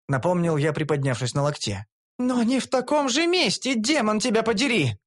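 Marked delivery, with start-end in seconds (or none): 2.2-5.0 higher, indignant female voice; lightly voiced elf, indignant. A narrator's reading. Elf snapping at hero in the cell, indignant. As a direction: snap back in a raised girlish voice.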